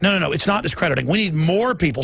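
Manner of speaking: ordinary talk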